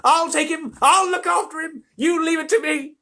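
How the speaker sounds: put-on high, loud, insistent old voice; eager old man. Grandpa Joe pleading to take Charlie, high and loud. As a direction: beg to be chosen. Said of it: "shouted Grandpa Joe".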